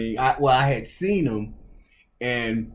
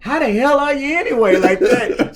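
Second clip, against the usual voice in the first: high coach imitation, laughing at the end; coach's voice; 12 semitones higher, 5 dB louder than usual. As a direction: play the coach apologizing, pitched high. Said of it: "And he says".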